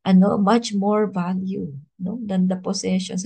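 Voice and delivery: ordinary talk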